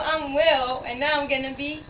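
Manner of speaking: ordinary talk, nothing marked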